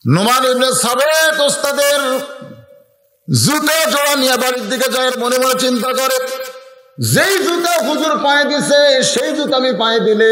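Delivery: high sermon storytelling; sustained high preacher's recitation; preaching. Acted. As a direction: pitch the tale up high for the crowd. Preacher tells the tale in chant.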